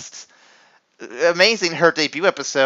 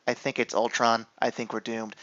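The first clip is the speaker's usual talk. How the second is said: low, much softer doom; mock dread; 5 semitones lower, 11 dB quieter than usual. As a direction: murmur it like all hope is lost. [name] voiced, quiet.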